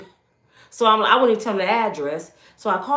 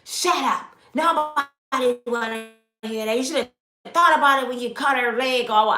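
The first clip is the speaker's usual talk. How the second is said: imitating rude cop; 4 semitones higher than usual. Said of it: "The bad cop was telling me to shut up".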